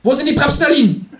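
very high, loud demand; piercing talking-animal interrogation; interrogating kangaroo. Acted. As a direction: yell the question in a high creature voice.

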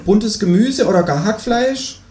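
a cheeky kangaroo asking for eggs. Acted. Kangaroo voiced in read story. (das Känguru bittet um Eier, mit hoher Stimme)

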